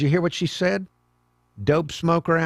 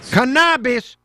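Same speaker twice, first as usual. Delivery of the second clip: loud mock echo of Cannabis, as the guest says it; hammed-up mimicry of the guest's accent; mocking foreign accent; 9 semitones higher, 7 dB louder, slower than usual. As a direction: shout Cannabis back in her accent. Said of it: "cannabis can".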